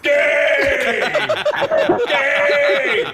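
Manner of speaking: high yelled taunt; mock shouting. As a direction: yell it like a buzzer. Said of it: "Todd's back there going".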